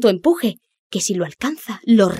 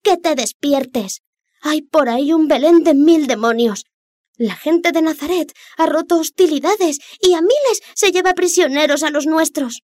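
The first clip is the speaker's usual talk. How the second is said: high, urgent fish voice; urgent little fish; 8 semitones higher, 4 dB louder than usual. Acted. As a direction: sound the alarm, pitched up. Little fish urges the Rex.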